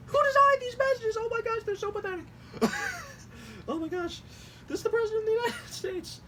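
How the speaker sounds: very high squeal through real laughter; helpless laughing. Real, not acted. Host scorns the president's texts, laughing helplessly. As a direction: squeak the words out, breaking into laughter.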